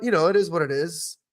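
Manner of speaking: ordinary talk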